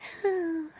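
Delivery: long sad sigh, pitched high; high mouse sigh. A narrator's reading. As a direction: sigh like a tiny sorrowful mouse.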